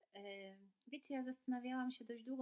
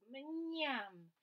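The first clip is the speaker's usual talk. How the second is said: higher, louder delighted yum; yum sound; 5 semitones higher, 8 dB louder than usual. Acted. Animals tasting the soup together, delighted yum. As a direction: savour it out loud.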